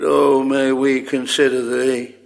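ordinary delivery

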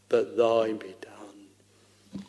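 slow hushed whisper of surrender; whispered prayer. Acted. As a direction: breathe the prayer out, barely voiced. Christ's prayer in Gethsemane, whispered.

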